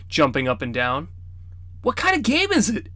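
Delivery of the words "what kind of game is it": put-on girl asking. A narrator's reading. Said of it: "Enna asked".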